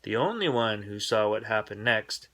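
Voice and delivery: ordinary delivery, nothing marked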